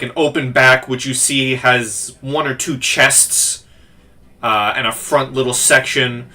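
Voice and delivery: ordinary delivery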